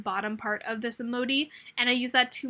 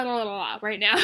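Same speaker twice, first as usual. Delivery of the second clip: ordinary talk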